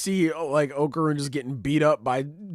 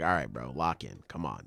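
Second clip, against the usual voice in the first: deep bro pep talk; 10 semitones lower, 6 dB quieter than usual. Retold or imitated. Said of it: "be like".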